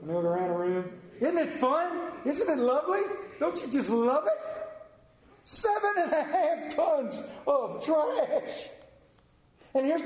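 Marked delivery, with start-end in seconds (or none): 1.2-4.6 high, sing-song sarcastic cheer; mock cheerful. Preacher teasing the congregation with mock delight. 5.4-8.7 slow and high, savoring each word; drawn out. Acted. Preacher marveling at the pile, slowly. Dwelling on the amount.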